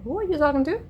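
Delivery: high-pitched, mock-questioning call; retold questioning. Woman checking on her friend's chats, retold questioning. Retelling how she questioned him.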